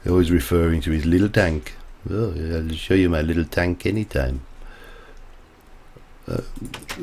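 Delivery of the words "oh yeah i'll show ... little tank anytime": lightly camp, teasing officer voice; a hint of a camp German officer; mildly camp, coy delivery; camp Gruber impression. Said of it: "he was very camp".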